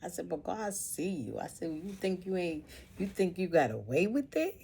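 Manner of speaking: retold scolding. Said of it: "I'd be like".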